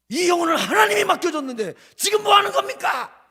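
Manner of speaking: high, loud indignant demand; loud furious rebuke; angry shout. Retold or imitated. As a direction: hurl the question at him. Retelling his rebuke of the pastor.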